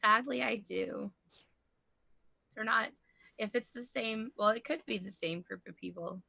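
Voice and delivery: softly spoken, halting confession; quiet admission. Acted. Character confesses a recanted deal to a companion, quiet admission. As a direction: admit it reluctantly, in a low volume with pauses.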